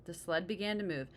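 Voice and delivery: ordinary delivery, nothing marked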